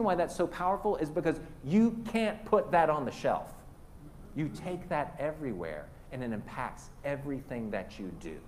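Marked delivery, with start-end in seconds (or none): none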